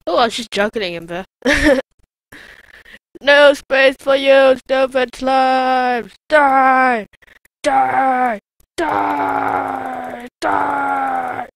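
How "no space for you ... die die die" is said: loud, slow drawn-out war cry; battle shout. Real, not acted. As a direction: scream down the slimes. Battle cries at slimes.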